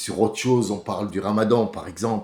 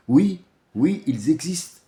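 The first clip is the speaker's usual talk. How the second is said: normal talking voice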